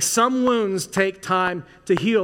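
some wounds take time to heal, half speed; slow emphatic repeat. Shown as a demonstration. Pastor to church, slow deliberate repeat. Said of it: "pastor repeats line for emphasis".

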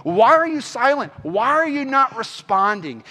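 acted-out demand for answers from God; pleading with God. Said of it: "We're like".

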